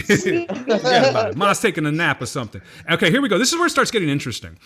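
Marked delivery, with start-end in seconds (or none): 0.1-1.4 slow, laughing mock Italian-American forget about it; Italian-American accent. Man laughing at his ancestry results, repeating it in a slow Italian-American accent.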